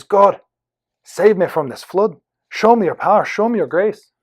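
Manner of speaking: put-on high, loud Irish-accented plea. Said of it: "I'm going to do an Irish accent".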